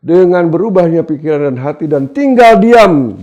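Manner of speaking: high, loud exhortation; full-force preaching. Real, not acted. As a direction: preach it in driving, insistent phrases.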